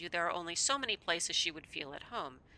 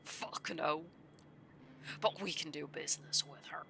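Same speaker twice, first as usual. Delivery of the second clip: put-on gruff old man; 5 dB quieter than usual. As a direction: play the weary old drifter, half whispering.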